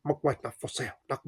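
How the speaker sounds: hushed mock Chinese accent of the grandfather; exaggerated Chinese accent. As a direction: mimic the old shopkeeper's exaggerated Chinese accent. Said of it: "this really exaggerated accent".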